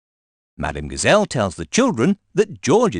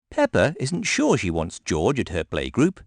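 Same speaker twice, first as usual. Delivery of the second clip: ordinary delivery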